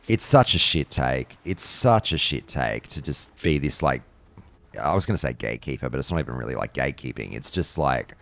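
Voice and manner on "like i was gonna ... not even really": ordinary talk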